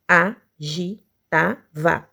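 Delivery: "Agitava" very slow, a-gi-ta-va; syllable by syllable. Shown as a demonstration. Teacher counting four syllables, "Agitava" slowly separated. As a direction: pause after each syllable of "Agitava".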